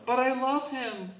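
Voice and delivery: high, mock plaintive whine; lovesick pleading. Believer making excuses about an unsuitable partner, mock plaintive. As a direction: plead like someone besotted, hammed up.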